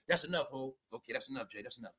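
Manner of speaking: soft low hushed voice, imitating [name]; mock [name]. [name] telling [name] that's enough, hushed and low. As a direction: whisper it down like a wife reining him in. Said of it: "She said".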